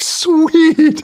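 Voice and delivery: high, loud, drawn-out mock cheer; elated. Officer hearing he got the house, retold elation. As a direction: re-enact delight with a soaring, stretched cry.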